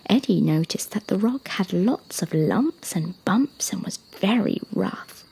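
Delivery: ordinary delivery, nothing marked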